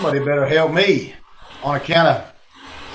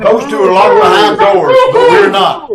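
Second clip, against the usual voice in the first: triumphant shout; 10 dB louder than usual. Acted. Blackie triumphant after locking them.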